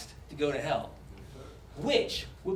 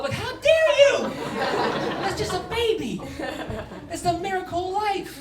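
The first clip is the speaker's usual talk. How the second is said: much higher, mock-offended scolding; mock outrage; 10 semitones higher than usual. Offended stranger scolds him, high-pitched and mock-outraged. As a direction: play the scandalised prude. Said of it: "indignant scolder".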